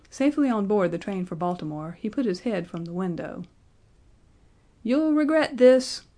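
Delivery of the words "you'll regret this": high, loud, slow parting shout. A narrator's reading. Benjamin shouting at the students from the train window. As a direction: yell it back from the train. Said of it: "he shouted".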